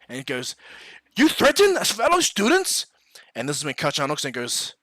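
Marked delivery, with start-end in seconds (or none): 1.2-2.9 high, loud outraged question; indignant. Acted. Student answers a classmate's threat, indignant. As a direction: challenge him in acted outrage.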